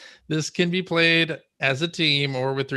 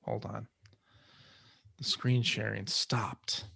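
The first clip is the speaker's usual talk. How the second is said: whispering; 3 semitones lower, 10 dB quieter, slower than usual. Real, not acted. Muttering while screen share fails.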